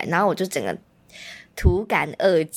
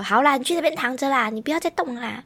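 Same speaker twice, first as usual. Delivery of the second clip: fussing mom; 6 semitones higher than usual. Retold or imitated. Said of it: "我妈就会说".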